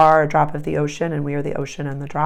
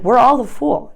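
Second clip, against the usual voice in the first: higher, louder playful declaration; voicing the Fool card; 6 semitones higher, 5 dB louder than usual. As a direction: announce it as the carefree Fool. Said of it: "but he's like".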